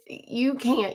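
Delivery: ordinary talk, nothing marked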